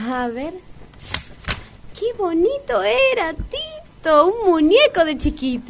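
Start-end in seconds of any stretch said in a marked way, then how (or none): 2.0-5.7 put-on much higher, delighted cooing over a cute child; gushing over a photo. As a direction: coo as if the baby picture melts you. (enternecida, mucho más aguda, como ante un bebé)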